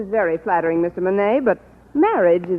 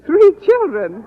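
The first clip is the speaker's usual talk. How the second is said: surprised; 7 semitones higher, 6 dB louder, slower than usual. Acted. Hears about children.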